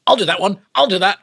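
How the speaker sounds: eager, high and loud volunteering; keen young actor jumping in. Retold or imitated. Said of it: "so I'm like".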